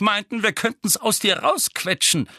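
schimpfend, aber noch gehalten; wütender Vernon. Acted. Vernon, erste Fassung des Satzes. (Vernon beginnt den Satz wütend, noch nicht brüllend)

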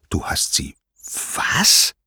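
whispered shock bursting on "was"; aghast, whispered question; stunned disbelief. A narrator's reading. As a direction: start in a whisper, then push out the last word breath-only. Poseidon shocked at the confession.

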